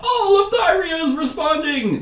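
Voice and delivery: very high-pitched, frantic alarm; hammed-up frantic high voice; frantic cry. Hunters returning from the northern lands, crying out frantically. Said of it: "they say frantically".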